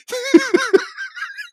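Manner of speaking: a high laugh breaking the sentence. Real, not acted. Laughs mid-rant about Jordan's jump shot. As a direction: cracks up mid-thought.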